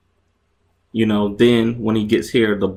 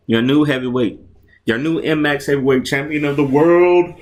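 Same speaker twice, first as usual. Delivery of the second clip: ring announcer; 4 semitones higher, 3 dB louder than usual. Acted. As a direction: announce the champ to the arena. Announces new champion.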